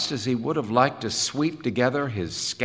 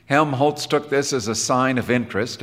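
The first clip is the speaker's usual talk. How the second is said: ordinary delivery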